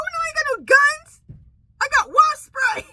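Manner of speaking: high, loud panicked yell, half-crying; panicked shout. Retold or imitated. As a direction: shout it in a panic, close to tears. Retold own panicked answer to dispatcher.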